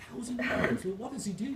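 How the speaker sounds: high-pitched mock disbelief; incredulous customer. Irish customer questioning the barman's price, incredulous. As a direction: jump up high in astonishment at the price.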